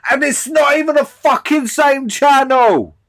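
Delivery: loud, high, fed-up outburst; exasperated yell. Real, not acted. Ranting at the video, a loud exasperated yell. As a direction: bark it out in disbelief. Ranting about a streaming mess.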